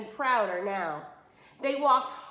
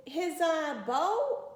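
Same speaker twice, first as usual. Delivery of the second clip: hesitant, rising high on bow; stammered surprise; 7 semitones higher, 3 dB louder, much slower than usual. Acted. Sisters stalling when asked about the bow, stammering. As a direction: fumble for the word, surprised. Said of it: "they stammered in surprise".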